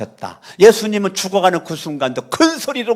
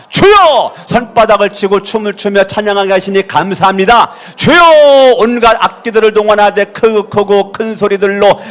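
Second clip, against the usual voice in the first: shouted cries with forceful chanted declarations; loud prayer; 7 semitones higher, 7 dB louder than usual. Real, not acted. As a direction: lead a congregation in full-force loud prayer, each cry leaping up. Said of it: "한번 큰 소리 한번 해보는 거요". (큰 소리로 부르짖는 기도)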